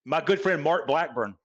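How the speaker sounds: ordinary talk, nothing marked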